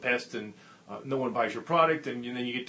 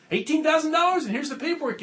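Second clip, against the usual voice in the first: much higher and louder, triumphant; excited; 10 dB louder than usual. Retold or imitated. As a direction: rookie waving his order sheet. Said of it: "and he's excited".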